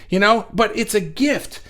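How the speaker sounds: usual speaking voice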